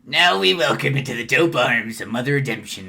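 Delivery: loud preacher welcoming a penitent; Gamzee. Acted. Clown preacher welcomes a penitent before the crowd, booming character voice. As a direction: boom it out to the congregation. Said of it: "I fucking love doing Gamzee's voice".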